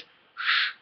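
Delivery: long unvoiced hush on "sh"; German sch sound. Shown as a demonstration. Teacher demonstrating the S H sound, long "sh". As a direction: hold "sh" as a hissing sound. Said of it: "That S H sound".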